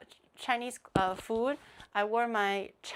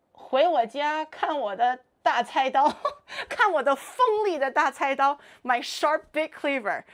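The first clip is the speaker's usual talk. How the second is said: giggly mock threat; mock menacing line; 4 semitones higher, 7 dB louder than usual. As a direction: ham it up like a cleaver-wielding creep.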